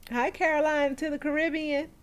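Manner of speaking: put-on high, loud greeting in Caroline's accent; the listener's accent. Host greets a viewer, playfully copying her accent. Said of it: "thinking in your accent".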